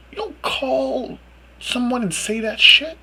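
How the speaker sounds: incredulous. Real, not acted. Incredulous rant at Mel.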